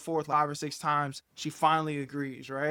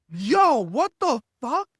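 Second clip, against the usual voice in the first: loud, high startled reaction; startled outburst; 7 semitones higher, 8 dB louder, slower than usual. Retold or imitated. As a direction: burst out as if jolted awake. Retells waking startled by girlfriend.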